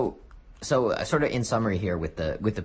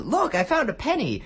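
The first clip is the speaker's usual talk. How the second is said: much higher, silly Family Guy stormtrooper; goofy stormtrooper voice. Retold or imitated. Mocking a Family Guy stormtrooper for his co-host, silly high voice. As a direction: squeak it out like a dopey soldier. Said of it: "that Family Guy thing where they had the stormtroopers".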